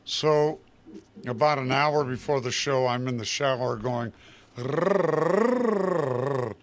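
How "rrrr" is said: rolled warm-up brrr, pitched up; vocal warm-up trill. Retold or imitated. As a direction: buzz a quick lip trill like warming up. Singer warming up in shower.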